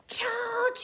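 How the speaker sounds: high, loud yell; rallying holler; yelled battle cry. A narrator's reading. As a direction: shout it to start an attack. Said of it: "he yelled".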